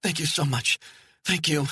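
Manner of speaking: high, breathless gratitude; overjoyed woman thanking the hiker; euphoric thanks. Acted. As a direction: pour out relieved thanks. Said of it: "Friel felt euphoric".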